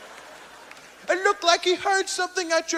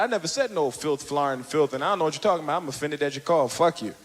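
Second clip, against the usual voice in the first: imitating Cosby; 14 semitones lower, 6 dB quieter, faster than usual. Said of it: "And I said".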